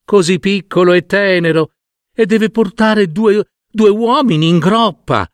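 a hint of a girl's complaint, high; young woman. A narrator's reading. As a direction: exclaim lightly as a village girl. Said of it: "disse una delle giovani".

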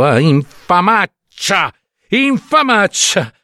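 high, slow furious cry; outburst of rage. A narrator's reading. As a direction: spit out the insult in fury. (scoppio d'ira, voce alta e lenta)

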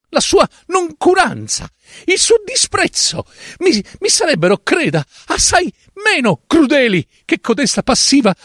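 high, fervent protesting character voice; impassioned character. Acted. Doctor Fileno lecturing the author on living characters, high fervent appeal. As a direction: argue for your life with passion. Fileno keeps pleading his case.

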